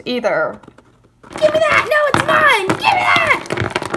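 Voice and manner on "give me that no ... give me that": put-on high-pitched bickering child voice; squabbling kids. Children fighting over a thing, shrill squabble. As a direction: squeal it like a kid fighting over a toy.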